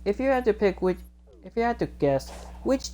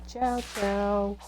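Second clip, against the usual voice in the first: higher, lightly voiced little creatures; Chocola and Cheese; 6 semitones higher than usual. Little companions say goodbye, lightly voiced and high. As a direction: chirp a small high goodbye. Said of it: "Chocola and Cheese say".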